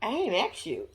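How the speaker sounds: a quiet, flat first try at the brush-off; dismissive comeback. Retold or imitated. Vlogger rehearsing her retort to a bossy child, quiet and dismissive. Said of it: "That's what I would've said".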